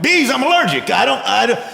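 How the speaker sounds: high, flustered mock panic; comic alarm. Peter panics on hearing of bees.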